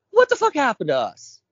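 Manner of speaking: usual speaking voice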